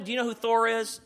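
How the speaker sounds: ordinary delivery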